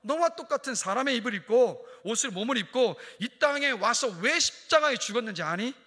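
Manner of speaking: acted divine reproach; the Lord's rebuke. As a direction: thunder it as the Lord confronting believers. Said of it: "주님이 이렇게 말씀하실 거라는".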